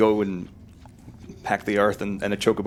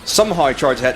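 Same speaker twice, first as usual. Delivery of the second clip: mock Southern Irish accent; 5 semitones higher than usual. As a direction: keep up the shaky Southern Irish impression. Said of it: "this isn't Southern Irish at all".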